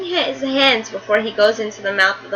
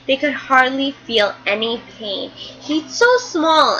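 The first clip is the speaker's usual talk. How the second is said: ordinary delivery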